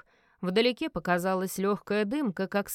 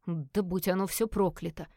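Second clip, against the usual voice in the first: usual speaking voice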